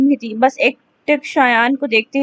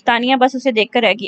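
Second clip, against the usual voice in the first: usual speaking voice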